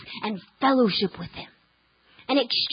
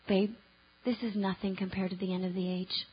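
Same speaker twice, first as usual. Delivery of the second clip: husband's calm voice; 5 semitones lower, 11 dB quieter than usual. Retold or imitated. Husband's calm reply quoted.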